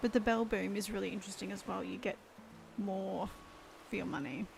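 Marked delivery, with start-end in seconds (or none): none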